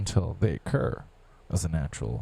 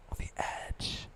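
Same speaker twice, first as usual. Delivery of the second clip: eerie whisper, much softer; 10 dB quieter, slower than usual. Pretend. Teasing the listener, eerie whisper. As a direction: hiss it like a secret.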